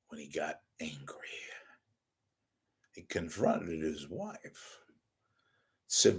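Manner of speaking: high, hushed true crime narrator; dramatic breathy crime show voice; Keith Morrison impression. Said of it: "do another Keith Morrison impression".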